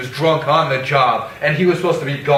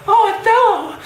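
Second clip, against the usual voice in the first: mock falsetto damsel cry; Desdemona; 4 dB louder than usual. Othello imitating his wife at the fight, mock falsetto cry. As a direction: squeal it very high, imitating a wife calling out. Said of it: "Desdemona was like".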